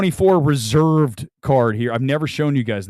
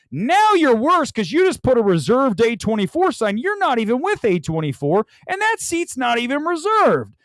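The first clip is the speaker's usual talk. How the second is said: mock outrage; 9 semitones higher than usual. Mock angry moviegoers.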